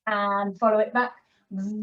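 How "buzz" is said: long droning bee buzz; buzzing bee noise. Shown as a demonstration. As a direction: hum a sustained "zzz" like a bee. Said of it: "make a good buzzing bee noise".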